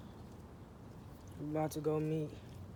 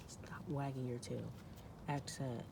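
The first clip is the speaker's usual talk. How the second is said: much softer, lower scolding; under-the-breath order to the dog; hushed aside; 4 semitones lower, 12 dB quieter than usual. Real, not acted. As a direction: keep the correction quiet. Hushed orders to dog while filming.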